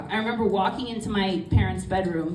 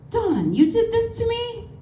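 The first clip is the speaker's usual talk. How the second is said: much higher, slow, wounded cry; shocked dismay; 3 dB louder, slower than usual. Retold or imitated. Mom realising her daughter caused it, high slow shocked cry. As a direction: stretch it out in hurt disbelief.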